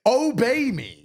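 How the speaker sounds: mock tyrant father. Father playing tyrant to his kids, hammed-up command. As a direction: ham up the king of the house.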